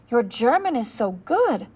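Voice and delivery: a hint of a captain's wife, slowed down; lightly voiced German woman. A narrator's reading. Captain's wife praising the boy's German at dinner, lightly voiced and slow.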